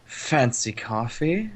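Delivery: amused disdain. Acted. Amused disdain at naive remark.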